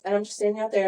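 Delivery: ordinary talk, nothing marked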